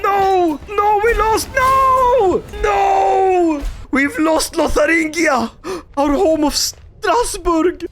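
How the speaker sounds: anguished cry. Real, not acted. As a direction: cry out in real dismay at the loss. Losing the battle.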